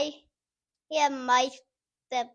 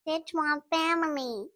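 Stitch voice; 6 semitones higher than usual. Acted. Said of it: "[name], you wanna give it a try?".

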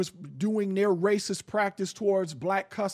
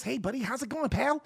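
a hint of chummy suburban voice; 6 dB quieter, faster than usual. Mocking how they say you should talk, chummy. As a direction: lightly put on a buddy-buddy tone. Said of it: "you can talk like this".

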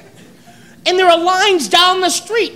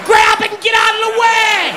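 exasperated yelling; 6 semitones higher than usual. Acted. Frustrated buffet rant, yelling.

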